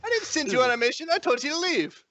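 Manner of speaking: high-pitched exasperated snapping; angry retort. Acted. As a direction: correct a boyfriend, out of patience. Girlfriend retorts in quarrel.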